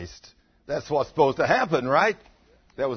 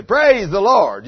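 slow, loud, drawn-out praise the Lord; 8 semitones higher, 13 dB louder, much slower than usual. Shown as a demonstration. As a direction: proclaim it slowly and loudly.

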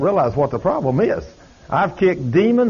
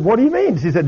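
high, louder startled question; retold alarmed question; 7 semitones higher, 4 dB louder than usual. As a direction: act out a taken-aback reply. Mother questions babysitter, retold.

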